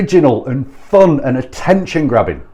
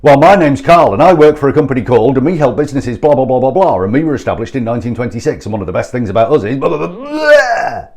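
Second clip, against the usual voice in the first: rushed, gabbled parody of a sales pitch; mock telesales gabble; vomiting a pitch; 4 dB louder, faster than usual. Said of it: "And then he threw up".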